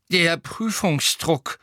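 put-on slow, high old man's voice; sympathetic old wizard. A narrator's reading. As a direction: draw it out slowly like an understanding old examiner. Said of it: "sagte der alte Zauberer mitfühlend".

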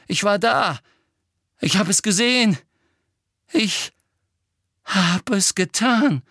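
loud, high, distraught outburst; insistent. Acted. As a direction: insist desperately on being believed. Boy insists dream was real. (aufgebracht, eindringlich und laut)

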